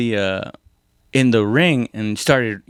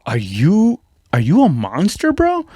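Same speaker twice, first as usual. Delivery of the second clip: stunned onlookers; 3 semitones higher than usual. Retold or imitated. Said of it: "everybody just looked at me like".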